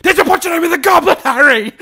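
high, loud, fast hammed-up yell; mock frantic Dumbledore. Host mocking Dumbledore grilling Harry, frantic yell. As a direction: shake him while shouting the question. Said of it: "And that's before we get to".

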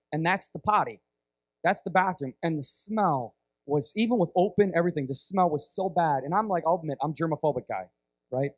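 normal talking voice

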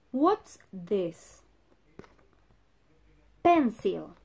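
ordinary delivery

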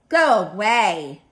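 loud, drawn-out grouchy shout. Acted. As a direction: shout them away like a grouchy bug. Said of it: "shouted the grouchy ladybug".

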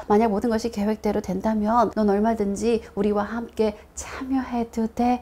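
ordinary talk

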